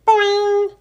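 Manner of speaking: loud, high springy boing call; a frog's loud twanging boing. A narrator's reading. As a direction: twang it like a frog.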